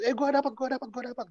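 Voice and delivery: high-pitched, gleeful repeated chant; imitated happy excitement. Teen girl still happy to get a counselor, high mock glee. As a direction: act out a delighted teen in a high voice. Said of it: "Masih senang".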